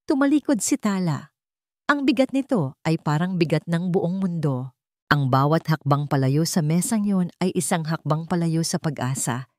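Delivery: plain reading voice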